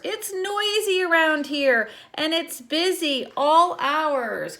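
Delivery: lightly lilting read-aloud; reading a picture book to children. Acted. Teacher reading the book to her class, lightly lilting.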